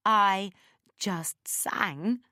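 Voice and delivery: I and SANG each set apart, high and loud; emphasized words. A narrator's reading. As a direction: punch "I", pause, then lean on "just sang". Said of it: "I emphasized the words".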